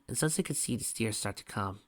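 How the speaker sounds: ordinary delivery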